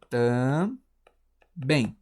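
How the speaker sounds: "também" split in two, very slow; long pause inside "também", between the syllables. Shown as a demonstration. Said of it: "esqueci de separar". ("também" bem devagar, sílaba por sílaba)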